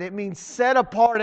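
normal talking voice

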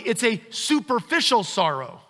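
ordinary talk, nothing marked

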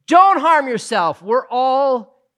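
calling out loud and high; loud cry. Acted. Paul shouting to the jailer not to kill himself, loud cry. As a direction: cry out to stop him. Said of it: "Paul cried out in a loud voice".

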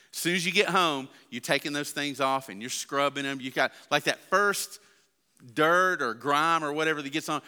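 normal talking voice

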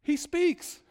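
high mock-sarcastic quip. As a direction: sneer at the long-silent man. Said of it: "And then Absalom says".

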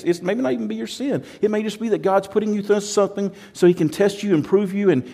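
ordinary talk

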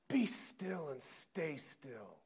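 slow command. Acted. Jesus commanding calm.

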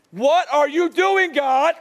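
a hammed-up cry of frustration; exasperated cry to God. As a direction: throw up your hands and call out to God, half joking. Retold frustration at the fallen hook.

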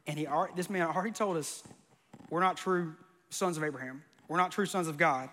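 normal talking voice

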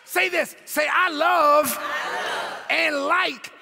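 high, emphatic exhortation to the congregation; impassioned sermon delivery; full-force preaching. Real, not acted. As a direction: drive each point home, rising to a cry.

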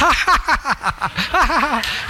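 high, loud mock laugh ha ha ha; imitating her laughter. Pastor imitates Brenda laughing at stressful news, high mock cackle. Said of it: "her default is laughter".

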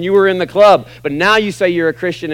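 ordinary talk

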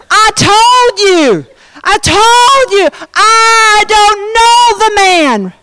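high, drawn-out exasperated shout; vehement sworn denial. Acted. Peter swearing to bystanders he doesn't know Jesus, vehement shouting. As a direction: hammer out each word slowly and high. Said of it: "and to swear on an oath".

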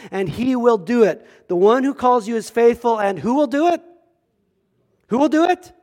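fervent sermon delivery climbing to a high ringing climax; preaching. Acted. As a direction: preach it out to the whole room. Preacher at full force, scripture.

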